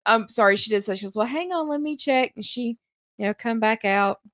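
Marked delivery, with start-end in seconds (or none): 1.2-2.3 put-on spry old voice. Old lady voiced in story.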